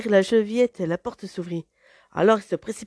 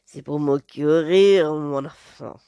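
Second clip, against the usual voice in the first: lower, slow, sly wolf voice; big bad wolf; 4 semitones lower, 5 dB louder, slower than usual. Wolf answers the girl's question, low and sly. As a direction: purr it slyly as the wolf.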